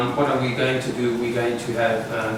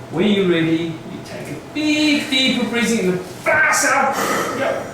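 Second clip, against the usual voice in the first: loud coaching; 11 semitones higher, 4 dB louder than usual. Real, not acted. Coaching a forced breath test.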